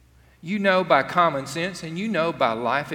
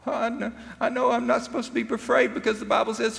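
anxious rush; 9 semitones higher, faster than usual. Retold or imitated. Voicing anxious believer.

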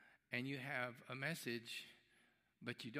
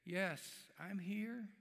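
a lightly voiced small distant reply, higher; tiny voice; 7 semitones higher, much slower than usual. Drunk man answering the husband from the dark, a small high voice. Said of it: "this tiny voice".

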